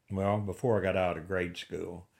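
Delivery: ordinary delivery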